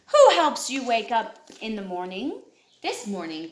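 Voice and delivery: ordinary delivery, nothing marked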